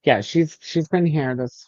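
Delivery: usual speaking voice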